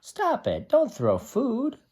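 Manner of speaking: high, playful scolding voice; mock scold. Teacher voicing a character scolding the throwing monkeys, high mock scold.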